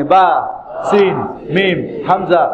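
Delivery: letter names in brisk succession; quick letter drill. Shown as a demonstration. Teacher telling learners to say it along with them, quickly. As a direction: run the letters together quickly. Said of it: "দ্রুত বলেন আমার সঙ্গে".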